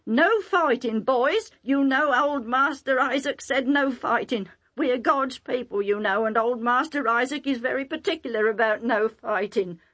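put-on cautioning young master. Young master reminding herdsmen of the rule, insistent. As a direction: mildly plead for restraint.

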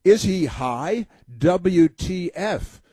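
hammed-up shocked tweet, slow and high; mocking outrage. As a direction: sneer out her disbelief, drawn out. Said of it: "she says".

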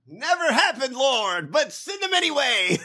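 lightly hammed-up radio preacher; mock preacher. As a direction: parody the preacher's call to the Lord. Mocking the preacher clip.

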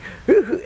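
short, high hoot of a laugh; demonic laugh. Retold or imitated. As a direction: imitate a prankster's brief evil giggle. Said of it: "Mike is laughing".

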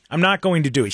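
usual speaking voice

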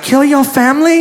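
high-pitched mock masseuse voice; imitated masseuse. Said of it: "She could have been like".

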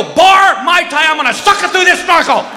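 high, loud exasperated shout; comic yell in a frenzy; shouted retort. Acted. Fleeing husband to wife after shark scare, shouting.

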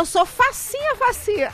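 high, giggly and playful; giggling. Real, not acted. Flattered host giggling at a listener's compliment, laughing high.

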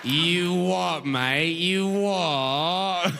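long, stretched British vowels; mock British accent. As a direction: parrot British sounds with relish. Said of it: "making sounds you like".

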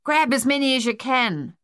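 high-pitched, excited order; spluttering. A narrator's reading. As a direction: call it out in a hurried splutter. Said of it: "Thaddeus spluttered".

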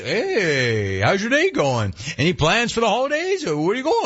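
high sing-song teller questions; high teller small talk; chirpy teller. Retold or imitated. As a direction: mimic the nosy teller. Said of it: "you're there to ask".

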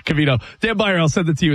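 ordinary talk, nothing marked

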